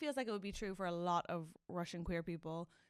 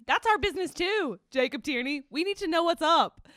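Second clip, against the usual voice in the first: louder, higher mock indignation; mock complaint; 6 semitones higher, 9 dB louder than usual. Real, not acted. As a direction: protest it with playful outrage. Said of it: "make a formal complaint about".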